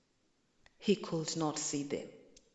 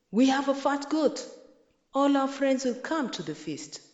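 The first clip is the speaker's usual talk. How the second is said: excited boast; 7 semitones higher, 4 dB louder than usual. A narrator's reading. Mwiti boasts of the feast.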